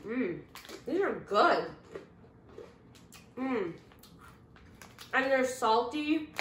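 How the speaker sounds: chewing while talking; talking between bites; mouth full. Real, not acted. Seen 2: bites at -4, jaw and lips chewing through labelled words.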